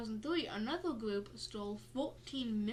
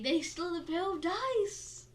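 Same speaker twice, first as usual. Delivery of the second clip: mock surprise; 6 semitones higher, 6 dB louder than usual. Mock disbelief about dice.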